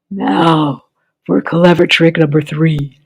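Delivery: low, slow sly villain voice; scheming crocodile. A narrator's reading. Crocodile to himself at the fair: low sly voice. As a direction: savor the next trick.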